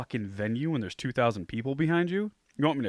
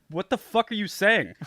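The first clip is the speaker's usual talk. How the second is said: exasperated; 9 semitones higher, 4 dB louder than usual. Retold or imitated. Frustrated bartender retold.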